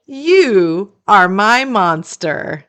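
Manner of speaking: plain reading voice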